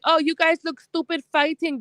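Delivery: lightly voiced haughty rival; mocking quote. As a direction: mimic her condescending tone. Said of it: "came up trying to be like".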